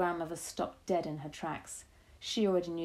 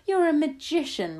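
high, loud cry of praise; excited shout; 9 semitones higher, 7 dB louder than usual. A narrator's reading. Grandmother praising grandchild's plan, shouting excitedly. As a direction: shout your admiration. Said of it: "my grandmother shouted".

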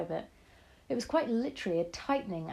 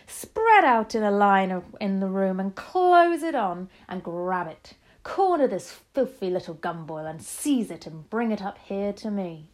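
loud commanding witch, easing toward the end; screamed orders; 7 dB louder than usual. A narrator's reading. Grand High Witch sending witches to seize the boy, screamed orders. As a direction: order the troops with heavy emphasis. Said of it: "screamed the Grand High Witch".

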